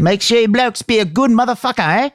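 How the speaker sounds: very high, hammed-up Aussie; Australian accent. Echoing the co-host in a high, exaggerated Australian accent. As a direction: echo it in a high cartoon Australian voice. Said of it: "it's hard to keep the accent".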